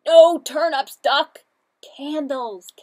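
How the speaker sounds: loud exasperated no; loud scolding. Acted. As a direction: shout it at the pest. Characters scold Duck.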